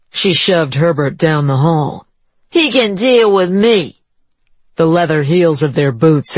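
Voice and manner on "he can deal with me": high, defiant woman; challenge, chin up. A narrator's reading. Juanita defiant.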